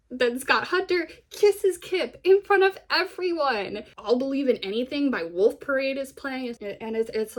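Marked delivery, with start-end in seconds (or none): 0.1-3.8 high, loud, drawn-out emphasis; excited reveal. Real, not acted. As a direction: announce the big moment slowly, word by word. Reveals the big climactic kiss.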